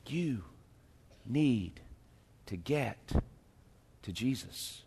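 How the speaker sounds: You, need, to get, to Jesus, each alone and soft; word by word, hushed. Real, not acted. Pastor repeating the point softly, word by word. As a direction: whisper it out one word at a time. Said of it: "hear this".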